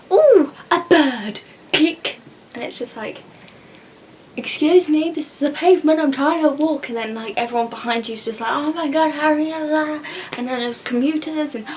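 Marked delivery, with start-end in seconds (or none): 0.1-2.2 loud, high, drawn-out mock amazement; hammed-up excited sightseer; mock tourist wonder. Mimicking tourists who stop to photograph, mock wonder. 4.3-6.9 louder, higher exasperated protest; indignant complaint. Acted. Commuter to tourists blocking the pavement, indignant. 8.5-10.0 higher-pitched exasperated mimicry; mock impatience. Mimicking walkers stuck behind tourists, mock impatience.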